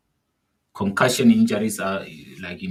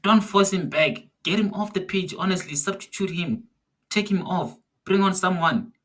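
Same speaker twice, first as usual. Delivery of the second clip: frustrated yelling; 8 semitones higher than usual. Real, not acted. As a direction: yell at the TV to get him off. Fan demanding substitution at TV.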